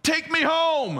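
high, loud yell; yelled chant line. Retold or imitated. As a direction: call it out to the whole street. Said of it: "you yell out".

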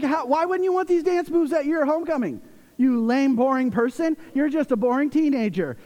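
high, animated teasing protest; hammed-up offended dad; mock indignant dad. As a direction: rib a teenager with comic outrage. Retold teasing of embarrassed daughter.

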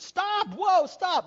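high, loud desperate commands; panicked screaming. Acted. Man on runaway horse at cliff edge, panicked screaming.

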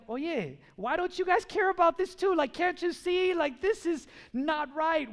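high, loud acted exasperation; worked-up, incredulous appeal; frustrated plea. Acts frustrated plea to uncaring people.

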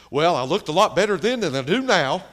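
loud retort. Retold or imitated. Old woman answering about her school picture, loud high retort. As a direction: throw it back loudly in her voice.

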